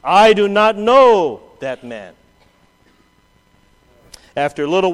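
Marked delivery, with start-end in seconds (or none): 0.0-2.2 slow, loud sworn denial; emphatic slow denial. Acted. Peter denying his Lord before all, slow and loud. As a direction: bang out each word of the denial. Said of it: "denied with an oath".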